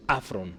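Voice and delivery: afron with the accent on the opening A; stress on first A. Shown as a demonstration. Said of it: "cómo se pronuncia".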